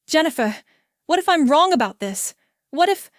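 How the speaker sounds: rising panicky pleading; anxious. Acted. Ciara doubting herself to Jennifer on the phone, anxious and rising. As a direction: voice climbing with worry. Panicked narrator calls agent.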